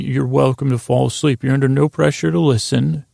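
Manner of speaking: normal talking voice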